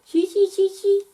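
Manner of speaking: very high squeaky chee; monkey chatter. Acted. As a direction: chatter back like a cheeky monkey.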